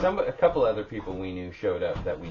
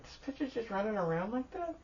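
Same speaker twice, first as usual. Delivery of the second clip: incredulous; 14 semitones higher, 7 dB quieter than usual. Retold or imitated. Retelling shock at a costume.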